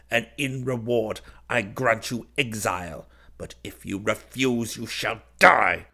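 furious commands, building to loud peaks; shouted. A narrator's reading. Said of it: "I shouted".

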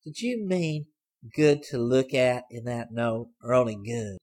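put-on sly girl. A narrator's reading. Girl surprising Hayes from the back seat, teasing. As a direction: pipe up high and pleased with herself.

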